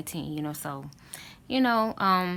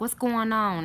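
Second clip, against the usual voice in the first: alarmed father; 6 semitones higher, 5 dB louder, slower than usual. Retold or imitated. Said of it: "He was like".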